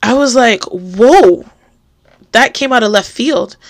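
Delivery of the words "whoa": stunned exclamation, retold; mildly re-enacted astonishment; shocked. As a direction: let out a startled reaction. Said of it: "I just was completely shocked".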